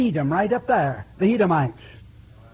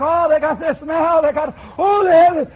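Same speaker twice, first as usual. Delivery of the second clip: higher mocking voice, louder at the end; envious scoff; 6 semitones higher, 5 dB louder than usual. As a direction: sneer that they've got it easy. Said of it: "you think".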